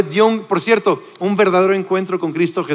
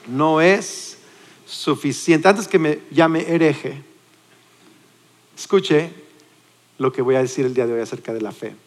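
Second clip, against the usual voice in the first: ordinary delivery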